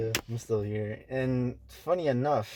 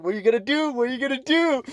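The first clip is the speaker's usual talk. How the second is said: high, loud taunting challenge; mock taunting yell; 14 semitones higher, 7 dB louder, faster than usual. Taunting the angry customer, high and loud. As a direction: squeal the challenge like picking a fight.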